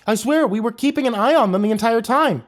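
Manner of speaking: high, loud panicked cry. Acted. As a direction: blurt it out in panic. Panicked counselor defends herself.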